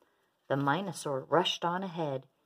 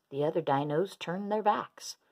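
ordinary delivery

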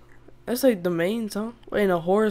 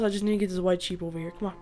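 usual speaking voice

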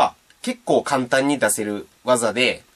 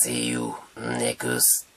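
very slow, much lower, warped like a slowed tape; slowed-down deep voice; 13 semitones lower, 9 dB quieter, much slower than usual. Acted. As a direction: drag it out in a deep, distorted voice. Slowed, distorted outro sign-off. (スロー再生のような低く歪んだ声)

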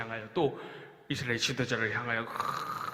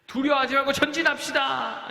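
rousing call; 10 semitones higher, 5 dB louder than usual. Acted. Joshua urging the people.

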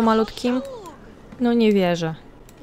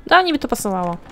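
ordinary talk, nothing marked

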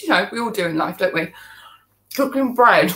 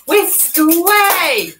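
playful cheer; 7 semitones higher, 5 dB louder, much slower than usual. Pretend.

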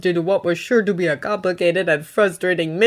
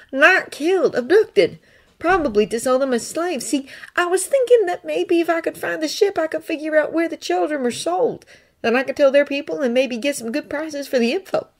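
put-on higher, earnest young Jedi voice; Thonne's voice; 9 semitones higher than usual. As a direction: lift the voice up for Thonne, sincere and pleading.

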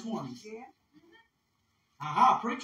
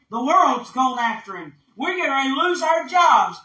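building from raised voice to high, loud shouting; alarmed Pharisees; 7 semitones higher, 9 dB louder than usual. Acted. Pharisees fearing they will lose everything, alarmed shouting. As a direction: shout it as mounting mock panic.

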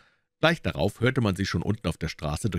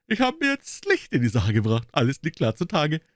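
pompous detective; 9 semitones higher than usual. A narrator's reading. Gregson boasting to Holmes about his case. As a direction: puff yourself up with pride.

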